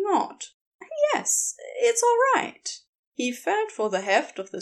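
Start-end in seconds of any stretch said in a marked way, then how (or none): 1.1-2.7 much higher, lightly put-on reassuring voice; Aziraphale's voice. A narrator's reading.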